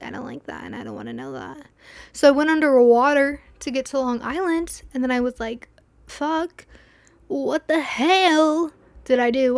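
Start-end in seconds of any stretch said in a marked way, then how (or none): 0.0-1.7 uneasy mutter. Retold or imitated. Uneasy reaction to being underwater. 6.1-9.6 alarmed outburst. Retold or imitated. Panicked realization on Long Island.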